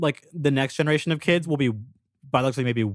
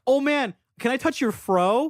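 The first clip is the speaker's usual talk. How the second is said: mock fascination; 8 semitones higher, slower than usual. Mimics an intrusive fascinated stranger.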